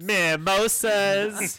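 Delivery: slow sassy drawl. Pretend. Joining the sassy bit.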